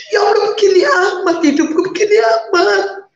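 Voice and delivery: high, crying plea to God; tearful lament. Retold or imitated. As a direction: cry out to God in despair.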